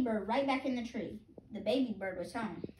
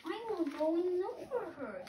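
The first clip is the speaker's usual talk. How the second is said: high, small chick voice, lost and asking; a baby bird piping for its mother; 9 semitones higher than usual. Acted. Hatchling searching for its mother, high piping voice. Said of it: "my baby bird voice".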